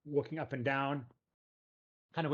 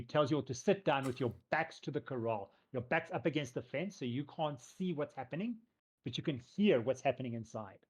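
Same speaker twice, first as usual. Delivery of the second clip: ordinary delivery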